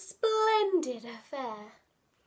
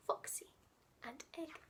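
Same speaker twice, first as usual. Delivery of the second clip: hushed title; 14 dB quieter, faster than usual. Acted. Title announced to children.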